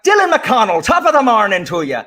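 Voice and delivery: high, loud mock Irish greeting; hammed-up Irish brogue calling a pupil; Irish accent. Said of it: "without doing an Irish accent".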